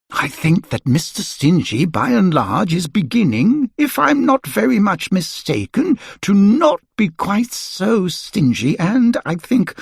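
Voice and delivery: a hint of a musing wizard; lightly voiced wizard. A narrator's reading. Wizard musing to himself about Mister Stingy. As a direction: muse smugly to yourself.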